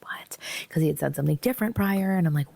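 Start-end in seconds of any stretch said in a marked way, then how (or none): 0.0-0.4 barely voiced, baffled; hushed confused what. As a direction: whisper your confusion under breath. Confused inner reaction.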